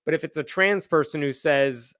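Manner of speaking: normal talking voice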